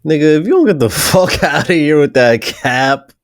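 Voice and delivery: loud laugh breaking through the words; laughing loudly. Real, not acted. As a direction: crack up while calling it out. Streamer cracks up at the excuse.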